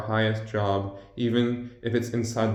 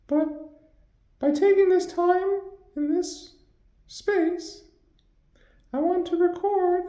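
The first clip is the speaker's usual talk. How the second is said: much higher, pitched-up voice. Acted. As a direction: talk in a squeaky high voice. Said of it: "Your voice can be very high".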